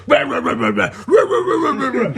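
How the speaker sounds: imitating the dog straining at the leash; mock dog barking. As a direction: rah like a snarling dog. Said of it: "this dog's like".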